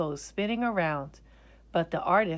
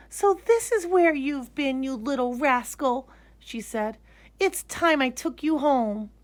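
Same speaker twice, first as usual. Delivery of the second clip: put-on higher, tender scolding child; mock-scold the bear lovingly; fond little girl; 7 semitones higher than usual. Lisa cuddling her bear, fondly scolding.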